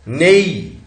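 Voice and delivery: नेई loud and alone, its glide stretched; isolated syllable. Shown as a demonstration. Teacher counting syllables for students, isolated demonstration. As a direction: sound out नेई firmly by itself. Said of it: "हमारे पास syllable कितने हैं?".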